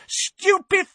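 very high, far shriek; curse cry. A narrator's reading. Bellatrix cursing Harry, very high shrieking cry. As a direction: fire the spell.

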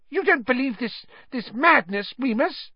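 high squeaky plea; Pettigrew, pleading. A narrator's reading. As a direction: appeal desperately to a friend in a shrill voice.

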